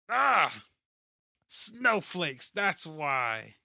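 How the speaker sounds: high, dismissive scoff; mocking coworker. Imitating a coworker who dismisses the talk, with a high scoff. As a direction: toss it off with a sneer. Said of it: "one of my other coworkers came in".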